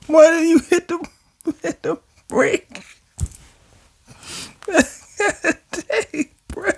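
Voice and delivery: high voice fading into breathless laughter; laughing question. Real, not acted. Reader asks about the brake, high and laughing. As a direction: ask it while cracking up. Cracking up at the crash.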